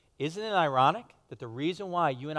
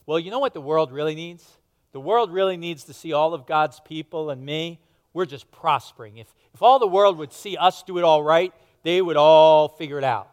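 smug, boastful voice of pride swelling much louder to the end; 8 dB louder than usual. Pretend. Pastor voicing pride boasting to the congregation, smug and building much louder. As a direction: puff up like a self-satisfied braggart. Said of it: "Pride says".

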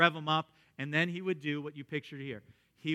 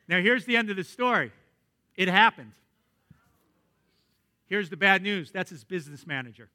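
normal talking voice

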